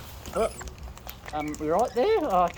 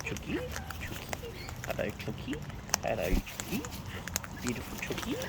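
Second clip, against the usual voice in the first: soft cooing; 6 semitones higher, 9 dB quieter than usual. Real, not acted. Cooing to chicken softly.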